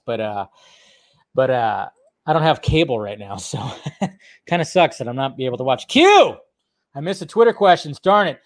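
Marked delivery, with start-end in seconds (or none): 5.9-6.4 a high, loud cry of a name; sudden call-out. Real, not acted. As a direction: shout the name as it hits you. Calls out to viewer.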